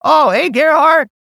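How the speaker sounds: high-pitched, stammering phone greeting; fooled friendly colleague. Acted. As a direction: act out someone pretending to recognise the caller. Acts out the fooled colleague answering.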